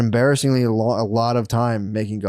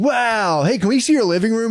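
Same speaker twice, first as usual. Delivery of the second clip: high-pitched imitation of gushing TV crew; mock eagerness; 8 semitones higher than usual. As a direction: play an impressed, nosy crew, pitched up. Voices eager reality show crew.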